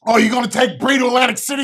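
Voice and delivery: fast, loud mocking whine of the ex-wife; mock shout. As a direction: throw her complaint back at her in a mock yell. Mocking wife's complaint.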